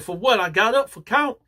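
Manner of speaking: high-pitched, incredulous protest; indignant protest. Retold or imitated. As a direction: protest an unfair lockdown. Protests being put on lockdown.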